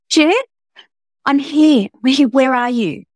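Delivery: voice wobbling with tears. Acted. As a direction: let the voice wobble on the verge of tears. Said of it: "Her sister's voice wobbled with tears".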